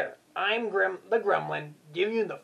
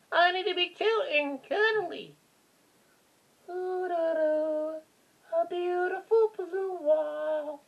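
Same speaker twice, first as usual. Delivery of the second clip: high, cutesy sing-song; cute and cuddly voice; 10 semitones higher than usual. Pretend. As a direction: coo it up high, drifting into la-la singing. Playing cute, singing to himself.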